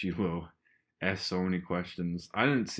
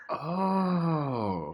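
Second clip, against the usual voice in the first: long, higher drawn-out "oh"; dawning realization; 8 semitones higher than usual. Retold or imitated. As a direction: re-enact the penny dropping. Acting out a sudden realization.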